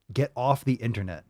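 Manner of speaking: normal talking voice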